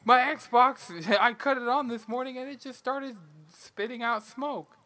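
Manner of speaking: high, hammed-up gamer whine; high mock gamer voice. Voicing a fake Xbox owner.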